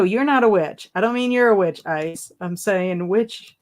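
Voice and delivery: usual speaking voice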